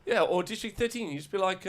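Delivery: put-on high South African accent. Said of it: "in our South African accents".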